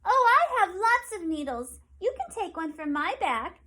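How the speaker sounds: Penny the porcupine, keen to help; eager porcupine. Acted. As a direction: offer it brightly as a small kind porcupine. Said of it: "said Penny Porcupine".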